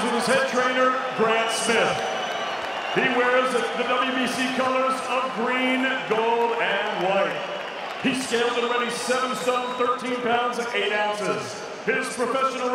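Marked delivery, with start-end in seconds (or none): none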